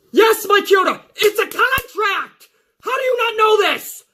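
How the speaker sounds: high, shouted disbelief; fed-up Carmella. Retold or imitated. Carmella scolds the referee, high and fed up. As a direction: scold him like he's hopeless. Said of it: "looking at her like".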